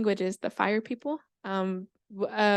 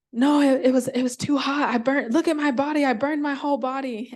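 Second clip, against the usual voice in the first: loud, higher-pitched burned snake; distressed snake; 4 semitones higher, 6 dB louder than usual. Acted. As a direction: complain in alarm about getting scorched. Burned snake tells of the heat.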